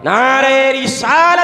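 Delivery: slow, loud drawn-out call; preacher leading a crowd chant, drawn out and loud; slogan call. Real, not acted. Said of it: "मैं नारा लगाऊंगा".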